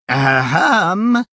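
loud, high ahem; loud ahem again. A narrator's reading. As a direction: clear your throat loud as a giant. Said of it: "These last sounds".

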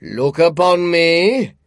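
slow, loud booming giant spirit; echoing ethereal energy being. A narrator's reading. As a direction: boom it out like a jolly giant. Ghost commands Scrooge.

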